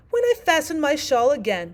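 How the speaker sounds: loud, high pained complaint; groaning out. A narrator's reading. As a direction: groan it as the poor Queen. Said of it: "the poor Queen groaned out".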